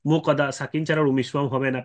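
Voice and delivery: ordinary delivery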